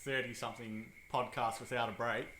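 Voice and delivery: ordinary talk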